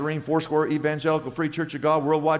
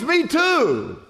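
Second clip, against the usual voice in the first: high, loud, eager acted reply; thrilled to find common ground; hammed-up joke-teller excitement; excited agreement; 10 semitones higher, 4 dB louder than usual. Passerby delighted at match.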